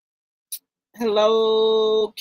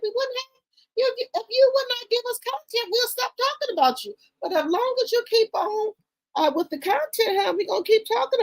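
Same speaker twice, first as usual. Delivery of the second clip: mocking high voice; 13 semitones higher than usual. Mocking message to Clarissa.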